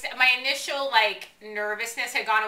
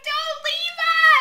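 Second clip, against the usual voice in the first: high, loud, drawn-out mock wail; pleading cry; 9 dB louder, much slower than usual. Fan begging the departing swimmer to stay, mock wail. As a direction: cry out a hammed-up plea at full pitch. Retelling a parting plea to swimmer.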